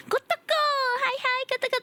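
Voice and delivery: high, delighted squeal of hello; excited greeting. Real, not acted. Streamer spotting Kotoko arriving in chat, excited greeting. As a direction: greet them in a high, eager burst.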